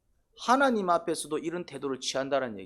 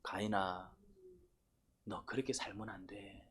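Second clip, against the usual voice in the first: low, soft, slow counsel; gentle admonishing; 5 semitones lower, 12 dB quieter, slower than usual. Acted. God correcting Cain for how he lives, gentle low admonishment. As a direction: reprove tenderly, taking your time.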